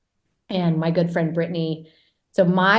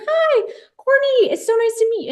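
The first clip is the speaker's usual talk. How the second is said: friendly greeting, imitated; 14 semitones higher than usual. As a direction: imitate a sweet star greeting a newcomer. Imitating friendly star greeting her.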